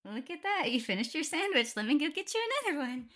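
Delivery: high, loud and fast, covering embarrassment; flustered. Acted. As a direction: blurt it out to change the subject. Flustered change of subject.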